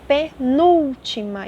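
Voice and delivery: pe-NÚL-ti-ma, slow and loud on "núl"; stressed accented syllable. Shown as a demonstration. Teacher giving a proparoxítona example, "núl" of "penúltima" stressed. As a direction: draw out "penúltima", punching "núl". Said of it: "ainda tem até o acento gráfico aí".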